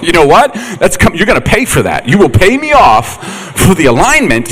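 loud, high acted scolding; strict dad. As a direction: lay down the law as an exasperated father. Acted strict father scolding his son.

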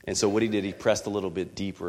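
ordinary talk, nothing marked

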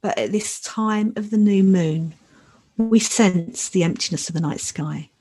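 calm and unhurried, long pauses; guided meditation. Acted. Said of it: "So now I'd like to lead us in a short new moon meditation".

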